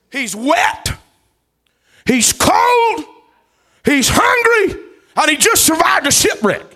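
high, loud, fervent preacher's cry; loud revival preaching; preaching at full force. Acted. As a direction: preach it out to the congregation. Preaching Paul's misery.

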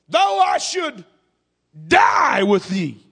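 slow, loud, breaking cry on die; crying shout. Acted. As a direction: vow it with tears in the voice. Peter's vow, acted.

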